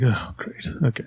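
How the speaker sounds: quiet, unimpressed mumble; sarcastic mutter. Retold or imitated. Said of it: "You're going in with a great attitude".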